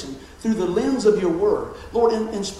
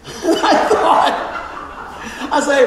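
laughing; 7 semitones higher, 6 dB louder, much slower than usual. Real, not acted. Laughing at the memory.

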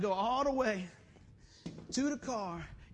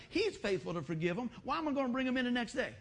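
usual speaking voice

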